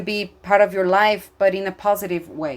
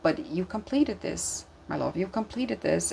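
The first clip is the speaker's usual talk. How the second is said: tender reassurance; 7 dB quieter than usual. Real, not acted. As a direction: gently tell them they made it through, my love.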